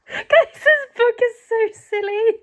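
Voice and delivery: high, loud laughing disbelief; laughing hard. Real, not acted. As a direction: squeal it through laughter. Laughing at the silly book.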